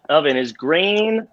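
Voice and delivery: usual speaking voice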